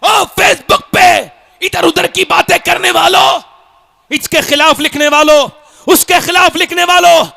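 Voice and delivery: loud, high-pitched exhortation; shouted sermon, pitched high and driven hard; full-force preaching. Real, not acted. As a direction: thunder a rebuke at the congregation.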